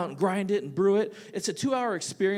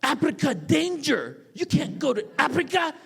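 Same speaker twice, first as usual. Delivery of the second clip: imitating dad's thick Korean accent, raised and louder; loud, worried warning in a heavy Korean accent; 10 semitones higher, 5 dB louder than usual. Immigrant dad forbidding the trip to Africa, thick Korean accent. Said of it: "in his thick accent".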